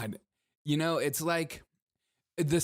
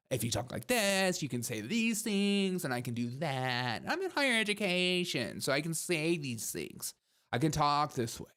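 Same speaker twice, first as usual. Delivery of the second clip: patronizing uptalk. Retold or imitated. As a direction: parody a lecturer talking down, pitch swinging. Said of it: "It shows that I'm being patronizing".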